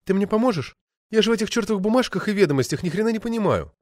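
lightly voiced woman begging a favour; pleading actress. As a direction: plead helplessly, speeding up.

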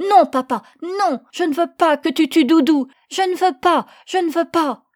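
put-on high, loud child's protest; frantic repeated refusal, shouted; desperate shouting. A narrator's reading. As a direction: cry out to stop your father. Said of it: "s'efforça de l'arrêter en criant".

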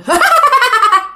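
high, loud spooky cackle; eerie laugh. Retold or imitated. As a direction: cackle like the possessed man. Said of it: "Cái ăn xong cái cười".

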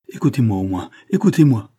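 plea building to a loud, high cry; supplication pressante. Acted. Il la supplie de l'écouter. (supplication qui monte jusqu'au cri)

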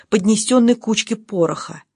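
ordinary delivery

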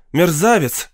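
shouting. A narrator's reading. Angry crowd abusing the professor, shouting. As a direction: cry out the insult. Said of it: "кричала толпа".

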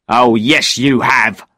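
mildly angry man. A narrator's reading. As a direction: contradict with a touch of anger. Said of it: "the man said angrily".

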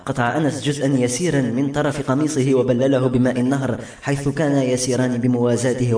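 ordinary delivery

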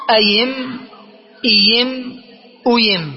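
أيم، إيم، أيم as ayimm, iyimm, uyimm; y glide in place of the second hamza in أيم، إيم، أيم; hamza turned ya. Shown as a demonstration. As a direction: demonstrate أيم، إيم، أيم one by one, humming out the m. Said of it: "نقلبها ياء مطلقا".

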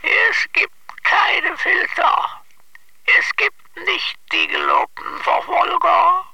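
high comic voice. Acted. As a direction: pitch it way up for the gag. Odd one-liners in put-on voice.

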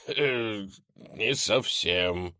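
very slow, pained reply; weak and hurt. Acted. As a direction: groan it out, winded. Dex hurt after hit.

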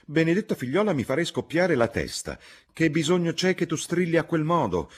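high, loud, exasperated scolding; scolding mother. A narrator's reading. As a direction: lay down the law to your daughter. Mother scolds her shouting daughter.

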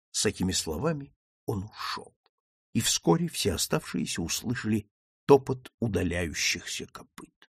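ordinary delivery, nothing marked

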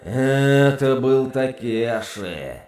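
slow, gloating demon growl; demonic growl. Acted. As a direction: growl it with relish. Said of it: "прорычал он с нескрываемым удовольствием".

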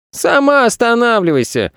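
high-pitched cheeky retort; boy shouting. A narrator's reading. Boy arguing back at planet Earth, shouting. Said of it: "крикнул Федя".

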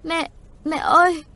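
put-on high, loud, slow child's wail; acted little-child crying call; bursting into tears. A narrator's reading. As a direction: cry out for mother like a frightened child. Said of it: "mở mắt òa khóc".

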